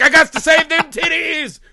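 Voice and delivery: hammy urgent hero voice; mock Frankenstein. Host voices Frankenstein racing to the finale, high laughing mock voice.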